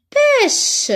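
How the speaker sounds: PÊCHE high then falling, drawn out; word modelling. Shown as a demonstration. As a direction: hit "pêche" high and let it fall. Teacher models word for learners. (« pêche » appuyé, étiré)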